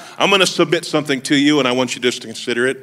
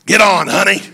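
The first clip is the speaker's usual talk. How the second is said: hammed-up lady driver with a cigarette; smoking bus driver; 5 semitones higher, 4 dB louder than usual. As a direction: play the smoker calling a kid aboard. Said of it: "lady bus driver smoked a big cigarette and was like".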